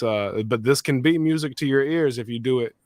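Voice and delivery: ordinary delivery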